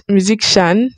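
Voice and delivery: musician said as "myu-zik-shan"; musician with k sound. Shown as a demonstration. Creator unsure of the word, says musician as "myu-zik-shan". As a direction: put a hard k before "shan" in musician. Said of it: "Is it musician or musician?".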